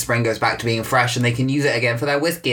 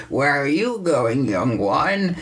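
slow, quavering elder's question; drawn-out old-timer voice; old person; 3 semitones higher, much slower than usual. Acted. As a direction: draw it out like a curious old hermit. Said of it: "this old person comes out like".

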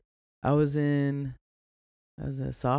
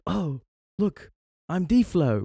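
higher-pitched mock British voice; light British impersonation, lifted in pitch; imitated British accent; 6 semitones higher, slower than usual. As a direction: put on a playful British accent. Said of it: "used the British accent".

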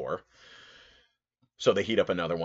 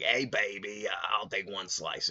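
lightly voiced smoker lady, slow drawl on the order; raspy old lady; 3 semitones higher, slower than usual. Lady orders her pizza at the counter, lightly voiced raspy old lady. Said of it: "In that kind of attitude, big hair".

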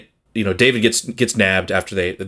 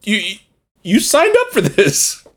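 exasperated scolding; 10 semitones higher than usual. Retold or imitated. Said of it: "And it's like".

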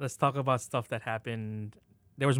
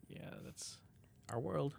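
resigned whisper; 2 semitones higher, 13 dB quieter, slower than usual. Retold or imitated. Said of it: "You're like".

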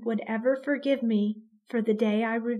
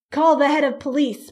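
louder urgent call; a father calling out for the police; crying out; 2 semitones higher, 7 dB louder than usual. Acted. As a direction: shout the order over the chaos. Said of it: "Papa cried out".

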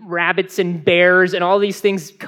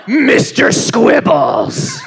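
loud, very slow drawn-out gladiator announcement; different voice; 4 semitones higher, 3 dB louder, much slower than usual. Acted. Panelist makes a hamster name aggressive, big announcer voice. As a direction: bellow the name, stretching each word. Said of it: "that's just singing in a different voice".

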